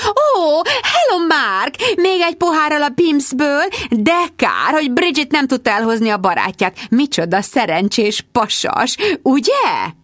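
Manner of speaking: magas, túljátszott társasági hang; agresszív csevegés. A narrator's reading. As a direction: csevegj magasan, tolakodó éllel. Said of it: "Mindezt roppant agresszíven mondta". (agresszíven negédes, magas hang)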